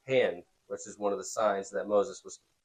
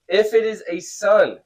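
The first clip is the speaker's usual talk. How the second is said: high, loud read-aloud; scripture reading; 9 semitones higher, 9 dB louder, slower than usual. A narrator's reading. Teacher reads Pharaoh's order to students, emphatic reading.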